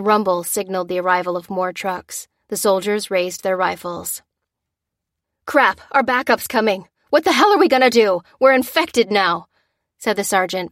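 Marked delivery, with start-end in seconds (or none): 5.5-9.5 loud, fast, higher panic; panicked despair. Acted. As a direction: lose it as the trucks arrive. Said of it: "pivoting wildly in despair".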